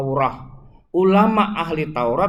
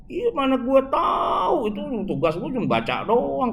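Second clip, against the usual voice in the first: imam's offhand reply; 8 semitones higher than usual. Retold or imitated. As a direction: mimic the imam, stretching the words high. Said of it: "Dia bilang". (menirukan imam berkelit dengan nada tinggi)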